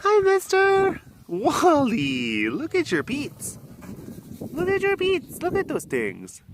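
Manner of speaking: put-on high cooing baby talk. Keeper cooing at the lion's paws, in high baby talk. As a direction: coo up high at a cute pet.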